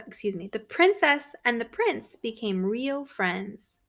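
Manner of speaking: ordinary delivery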